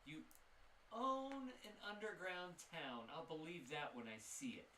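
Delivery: high, sceptical shopkeeper voice; scoffing shopkeeper. Acted. Shopkeeper scoffs at the party calling themselves town owners, high and sceptical. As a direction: laugh off their claim of owning a town.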